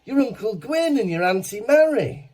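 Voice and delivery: high, lightly voiced elderly cousin insisting; old woman voice. Old woman telling the actor she is his cousin, high voice. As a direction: play the old lady, sure of herself. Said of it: "And she goes".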